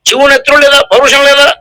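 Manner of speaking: high, lightly declaimed stage dialogue; historical-drama hero. Acted. Speaker repeating the play's dialogue to the audience, lightly declaimed hero. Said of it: "అనలేదు ఓ డైలాగులో".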